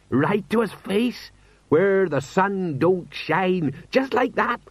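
high, drawn-out incredulous voice; pride or dread. A narrator's reading. Sergeant relives the insult to a lord, proud or dreading. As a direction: stretch each phrase high, marvelling at the nerve. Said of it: "whether this was a matter of pride or dread".

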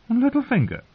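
high, amused scorn; chuckled mockery. A narrator's reading. Singer scoffing at the girl's threat, high amused mockery. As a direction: toss the name back high, mocking. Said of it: "he chuckled".

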